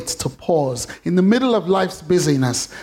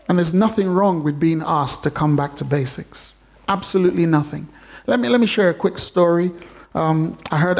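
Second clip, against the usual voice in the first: ordinary delivery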